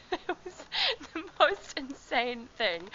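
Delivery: high, stretched out through giggles; really cracking up while telling it; laughing. Real, not acted. Cracking up recalling the packed bus.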